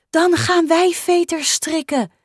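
proud. Acted. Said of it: "zegt Mieke trots".

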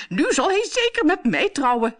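exclaim, thrilled about the prince; excited cry. A narrator's reading. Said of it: "riep Bertha".

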